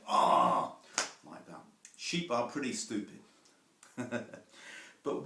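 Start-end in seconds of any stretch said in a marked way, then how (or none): 0.1-0.8 low, loud drawn-out "Ah" of exasperation; a frustrated shepherd watching his flock jump the wall; exasperated shepherd groan. Retold or imitated. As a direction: groan like a fed-up shepherd at the gate. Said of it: "the shepherd standing at the gate going".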